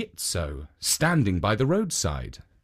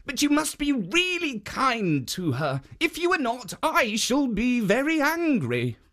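high, firm guardian warning; higher statue voice scolding the ogre; stern statue; 11 semitones higher than usual. A narrator's reading. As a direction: protect her, pitched high and firm. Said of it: "said Jitso".

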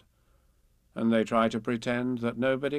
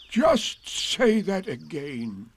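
very slow donkey voice, louder and higher; Eeyore; 5 semitones higher, 5 dB louder, much slower than usual. A narrator's reading. Eeyore asks Piglet to repeat it, slow and heavy. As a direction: stretch each word out heavily. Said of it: "he said".

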